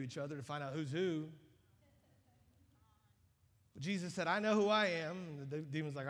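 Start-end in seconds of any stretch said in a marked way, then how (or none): 4.3-5.2 loud, higher proclamation of identity; authoritative declaration. Acted. Jesus answering the demon.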